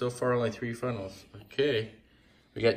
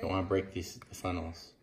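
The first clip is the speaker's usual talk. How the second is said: ordinary talk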